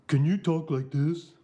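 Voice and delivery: imitating the English director. English director asking the actor to talk this way, imitated English accent. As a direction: mimic the lanky English director's voice. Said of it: "He said".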